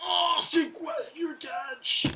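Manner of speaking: outraged shout. Real, not acted. Gamer outraged at the fielder's catch, high, loud and slow. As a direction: let your disbelief explode slowly.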